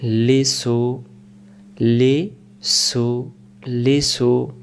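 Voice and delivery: stretched slowly, syllable by syllable; patient teacher spelling it out slowly; slow syllable drill. Shown as a demonstration. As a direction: draw out লিসু slowly for the pupil. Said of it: "সিন পেশ সু".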